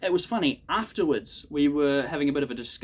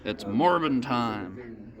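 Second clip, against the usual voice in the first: slow mock movie line; 2 semitones lower, much slower than usual. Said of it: "That was bad".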